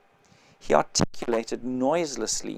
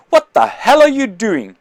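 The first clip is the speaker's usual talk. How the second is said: loud, much higher angry yell; shout; 9 semitones higher, 12 dB louder than usual. Acted. The intelligent man yelling at the narrator over the window. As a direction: snap at him in alarm. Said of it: "shouted the intelligent man".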